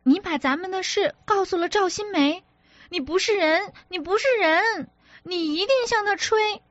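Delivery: livid, she can't believe he told; furious shrill outburst. A narrator's reading. Wife furious that he told his friend, high furious cry. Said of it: "脸全青了".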